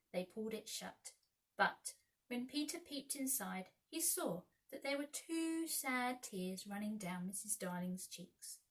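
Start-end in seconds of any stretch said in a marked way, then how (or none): none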